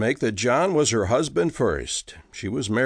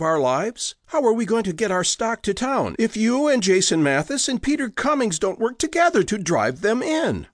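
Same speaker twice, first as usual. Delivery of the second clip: Josie's voice climbing higher as she argues; wife scolding her husband about the neighbors, rising higher; 6 semitones higher than usual. Acted. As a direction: let the indignation lift the pitch line by line. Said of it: "her own voice rising".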